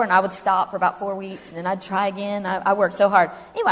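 ordinary delivery

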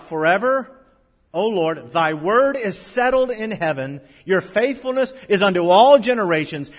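thundering scripture proclamation; preached scripture. Acted. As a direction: shout the promise out to the congregation. Same verse now preached loudly.

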